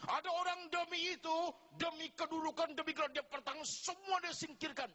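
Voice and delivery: impassioned high preaching. Real, not acted. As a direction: drive the point home with urgency.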